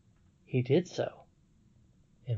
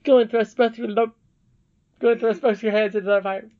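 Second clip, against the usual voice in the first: much higher, louder and fast, comic; hammed-up squeaky command; 9 semitones higher, 8 dB louder than usual. Shown as a demonstration. Reader clowning the princess's order, high and fast. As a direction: rattle it off in a silly high voice. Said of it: "this is hilarious".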